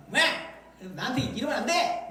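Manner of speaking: high, indignant mock complaint; imitating an angry prayer; acted anger. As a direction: protest like someone wronged. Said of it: "막 이렇게 막 화를 내는 거죠".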